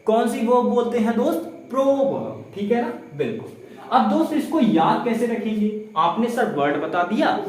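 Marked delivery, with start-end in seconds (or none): none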